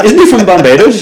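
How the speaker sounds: mock confused bystander.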